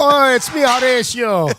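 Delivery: high, slow Jamaican crab voice; Jamaican accent. Retold or imitated. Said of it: "why does he have the Jamaican accent".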